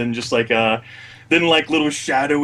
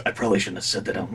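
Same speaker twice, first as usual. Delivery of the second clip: quick, soft and chuckling; laughing aside; 5 semitones lower, 7 dB quieter, much faster than usual. Acted. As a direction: murmur it with a guilty laugh.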